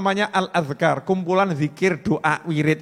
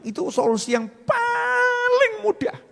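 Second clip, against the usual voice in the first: comic squeal; 3 dB quieter than usual. Acted. Comic whiny voice.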